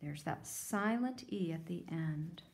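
normal talking voice

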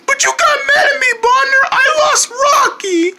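high, wailing sobbed words; crying. Acted. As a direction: blubber out the apology through tears. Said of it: "Don't cry, buddy".

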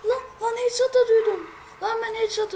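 high, loud outburst of frustration; shouting at the game in frustration; frustrated yell. Real, not acted. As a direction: yell it in disbelief at the screen. Frustrated after missed headshot.

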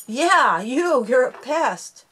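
loud, playful scolding; scolding the dog. Real, not acted. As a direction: tell off a pesky dog, loud and high. Scolding her pestering dog.

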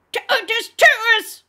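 high, very loud, slow demon growl; guttural ferocious voice. A narrator's reading. Demon forced to reveal the newcomer's motto, ferocious growl. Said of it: "the guttural, ferocious voice growled".

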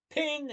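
high bright ping of the elevator bell. A narrator's reading. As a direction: chime it like a lift arriving. Elevator bell sound effect.

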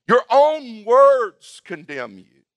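roar. Acted. King passing judgment on the servant, roaring. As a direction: draw the words out, loud and high. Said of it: "the king roared".

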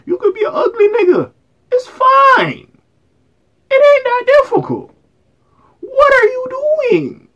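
high, loud, cracking disbelief; exasperated high whine. Real, not acted. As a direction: shriek it in outraged disbelief. Mocking the man on video.

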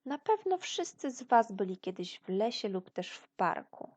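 usual speaking voice

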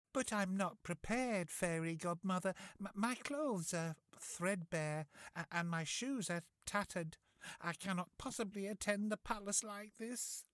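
put-on high, worried young girl; fretful, stammering young lady; young Cinderella voice. Cinderella frets to the fairy.

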